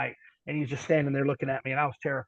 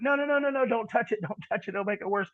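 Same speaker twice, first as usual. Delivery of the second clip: higher-pitched, rapid string of refusals; mock-alarmed pleading in a raised pitch; retold panicked protest; 7 semitones higher than usual. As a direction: plead to be left alone, re-enacting it. Retold panicked plea not to touch.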